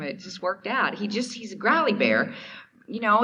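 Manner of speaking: ordinary delivery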